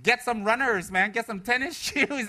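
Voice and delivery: loud, high teasing call across the street; a tempted, laughing retort, loud and high; joking retort. Retold or imitated. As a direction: shout the advice, half laughing. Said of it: "I was like".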